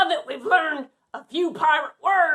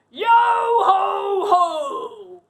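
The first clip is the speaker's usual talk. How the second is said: very slow, louder, higher pirate chant; happy pirate; 4 semitones higher, 4 dB louder, much slower than usual. Shown as a demonstration. Captain showing kids a happy pirate cheer, jolly and drawn out. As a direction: belt out a jolly "Yo ho ho". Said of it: "when you're happy".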